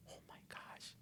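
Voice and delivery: barely audible, breathy awe; stunned whisper. Retold or imitated. Hands up, realizing he knows, stunned whisper.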